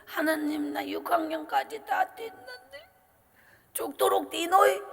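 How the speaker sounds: tearful prayer. Retold or imitated. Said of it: "제가 참 많이 울었는 것은".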